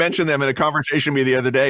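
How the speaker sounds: ordinary delivery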